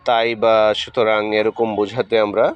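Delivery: ordinary delivery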